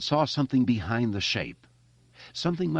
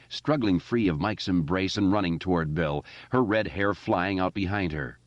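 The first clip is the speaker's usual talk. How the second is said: ordinary delivery, nothing marked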